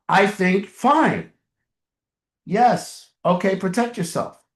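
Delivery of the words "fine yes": ordinary talk, nothing marked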